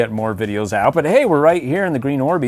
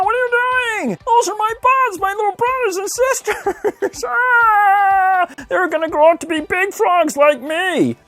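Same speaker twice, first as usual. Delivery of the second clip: very high, strained puppet frog voice; Kermit the Frog; slower than usual. Acted. Puppet frog defending tadpole brothers and sisters, high squawking. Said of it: "Kermit the Frog".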